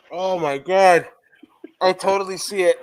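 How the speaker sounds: ordinary talk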